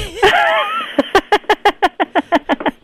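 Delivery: high cackling laugh. Real, not acted. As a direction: cackle at your own joke. Rit de sa propre blague. (gros rire aigu)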